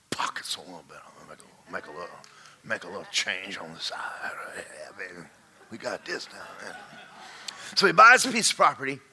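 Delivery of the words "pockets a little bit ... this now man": whispered aside while pocketing cash; sly whisper. Retold or imitated. Builder whispering about skimming money. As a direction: hush it like a secret skim.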